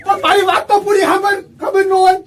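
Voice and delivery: desperate shout. Acted. Pleading to be heard, friend leaving.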